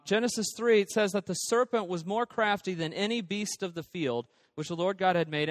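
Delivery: ordinary talk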